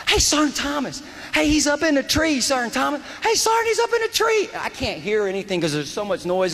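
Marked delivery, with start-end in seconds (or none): 0.1-4.5 retold yelling. Soldier yelling to his sergeant about a shooter, high urgent shout. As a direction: yell it high over gunfire. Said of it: "Floyd starts yelling".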